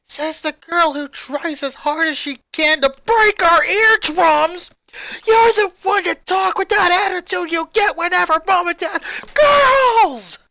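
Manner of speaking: high, loud furious screech. Acted. Sister screeches back at sister, furious and shrill. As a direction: screech it at full force. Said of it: "Lenny screeched".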